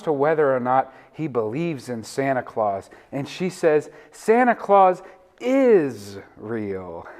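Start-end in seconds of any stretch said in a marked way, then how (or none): 4.1-7.1 slow, insistent "is", stretched out long; lightly voiced child insisting, leaning hard on "is"; admonishing child, stressed IS. Child admonishes older brother about Santa, stress on IS. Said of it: "young child admonishes her older brother".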